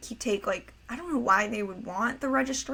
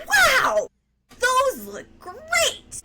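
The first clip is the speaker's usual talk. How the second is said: put-on very high, louder, slow awestruck old lady; imitating grandma; 6 dB louder, much slower than usual. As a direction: squeak out the praise like a dazzled grandma. Grandma imitated praising mom's glasses.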